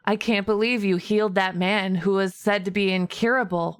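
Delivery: usual speaking voice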